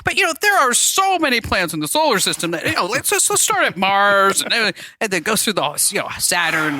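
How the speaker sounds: lightly voiced lecturing dad; a hint of a patient know-it-all father; folksy old sitcom dad. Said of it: "Barry would go on like".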